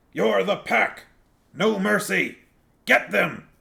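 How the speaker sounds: high, loud frenzied pack shout; rallying shout. Acted. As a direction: whip the pack into attacking. Big Fido rallies the pack.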